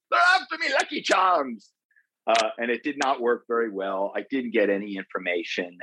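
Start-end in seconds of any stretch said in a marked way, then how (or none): 0.1-1.7 very high-pitched, hammed-up cartoon Irish; badly faked Irish brogue, pitched way up; lame Irish accent. Shown as a demonstration. Actor demonstrating his bad phone accent for the interviewer, lame Irish accent. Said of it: "kept repeating that in my head like a mantra".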